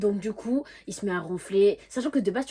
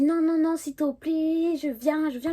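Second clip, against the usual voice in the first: high, loud, mock begging; imitated pleading; 8 semitones higher, 5 dB louder, slower than usual. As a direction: plead insistently, voice pitched up high. Le copain supplie au téléphone. (supplication imitée, haut perchée)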